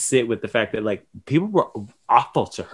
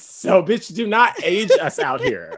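laughing protest; 9 semitones higher than usual. Real, not acted. Laughing protest at being aged.

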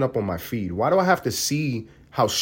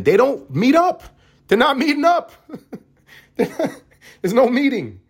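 high, loud words through laughter; cracking up at the thought; laughing; 12 semitones higher, 3 dB louder, slower than usual. Real, not acted. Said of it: "laughing at the idea".

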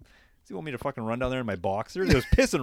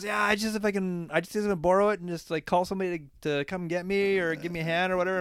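higher pitched mock stranger; lightly voiced stranger asking to borrow a phone; imitating the stranger; 6 semitones higher than usual.

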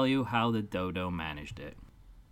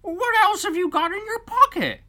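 dodo voice; 10 dB louder than usual. Acted.

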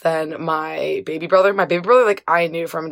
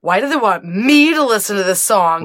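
mock denial; 5 semitones higher than usual. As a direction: play her teen self protesting too much. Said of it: "And I was like".

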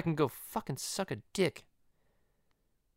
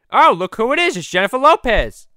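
high, loud cartoon surprise; excited greeting; 8 semitones higher, 9 dB louder than usual. Host spotting [name] arriving, loud excited voice. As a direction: announce the guest with high-pitched glee.

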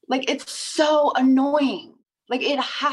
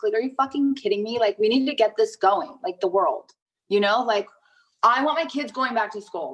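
usual speaking voice

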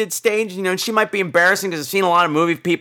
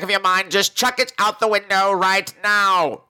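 booming newsreel announcer read; old radio announcer; 4 semitones higher than usual. Acted. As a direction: announce it like vintage breaking news. Mock news flash segment.

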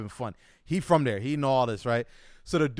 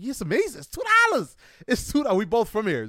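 excited; 7 semitones higher than usual. Retold or imitated. Telling brother-in-law the price.

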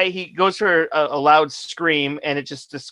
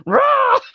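imitated shrill scream, much higher and louder; mock scream; 6 dB louder than usual. Retelling the singer's scream on the mic, loud mock. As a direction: bark a silly metal scream. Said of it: "he goes".

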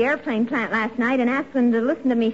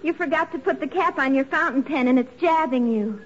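ordinary talk

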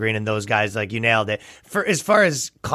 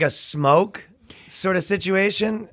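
usual speaking voice

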